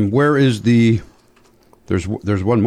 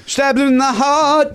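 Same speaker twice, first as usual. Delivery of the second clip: mock dramatic; 11 semitones higher than usual. Mock dramatic echo of advice.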